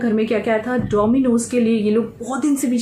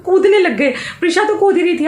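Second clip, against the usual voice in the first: excited laughing; 7 semitones higher, 4 dB louder than usual. Real, not acted. Excited retelling of jumping.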